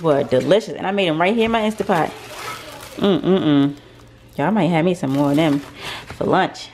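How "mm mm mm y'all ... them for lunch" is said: ordinary delivery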